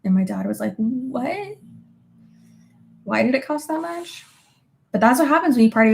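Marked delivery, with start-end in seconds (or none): 0.8-4.2 higher-pitched, mock-shocked dad question; incredulous dad. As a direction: imitate a dad balking at the bill. Imitates dad balking at trip cost.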